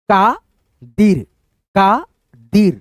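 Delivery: Qadir said very slowly, each sound held; slow demo. Shown as a demonstration. Teacher sounding out a name slowly for pupils. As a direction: stretch Qadir out slowly for the class. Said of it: "simple उच्चारण आ रहा है".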